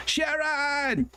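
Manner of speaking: a hammed-up rock star hollering for his wife. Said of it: "I can't do his accent".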